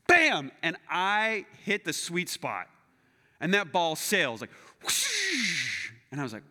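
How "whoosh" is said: long hissing whoosh; imitated whoosh. Golfer shows how the ball sailed, long whoosh. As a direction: mimic a ball sailing off through the air.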